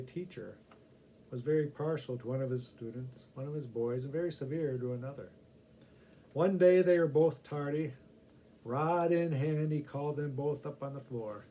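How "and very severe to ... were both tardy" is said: usual speaking voice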